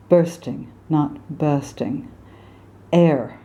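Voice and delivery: usual speaking voice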